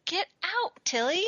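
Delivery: high-pitched scolding; shooing a pet. Real, not acted. Host interrupts recording to shoo her cat, scolding.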